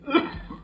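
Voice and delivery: harsh cough from the gas; choking cough. Acted. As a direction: cough as the fumes catch you. Gas fills the hall.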